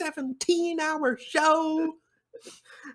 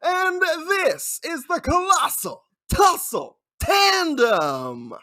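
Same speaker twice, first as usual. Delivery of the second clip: booming ring announcer intro; grand entrance announcement; 5 semitones higher, 6 dB louder, slower than usual. Acted. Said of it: "that was better than I expected".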